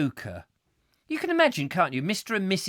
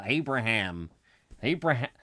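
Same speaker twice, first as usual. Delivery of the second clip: ordinary talk, nothing marked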